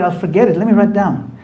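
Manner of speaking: ordinary delivery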